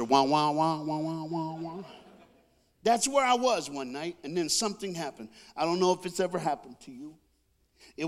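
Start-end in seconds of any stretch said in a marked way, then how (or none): 0.0-1.8 monotone "wah-wah" imitation of a teacher; droning teacher noise. Dozing pupil still hears the teacher, droning wahs. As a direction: drone like a muffled teacher heard half asleep. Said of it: "you can still hear the teacher".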